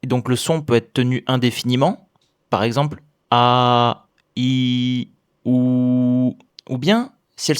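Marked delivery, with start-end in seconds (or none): 2.5-4.1 "a" held long and resonant; long vowel. Shown as a demonstration. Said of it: "se termine par une voyelle longue". 4.4-5.1 "i" held long and steady; long vowel. Shown as a demonstration. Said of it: "se termine par une voyelle longue". 5.4-6.4 "ou" held long and steady; long vowel. Shown as a demonstration. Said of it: "se termine par une voyelle longue".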